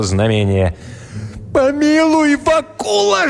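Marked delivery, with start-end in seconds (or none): 1.5-3.3 very high, plaintive wail; pitiful moaning devil. A narrator's reading. Devil threatened with the cross, moaning pitifully. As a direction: beg for mercy in a squeaky devil voice. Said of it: "жалобно простонал чёрт".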